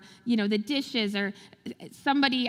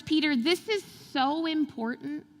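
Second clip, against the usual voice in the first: ordinary talk, nothing marked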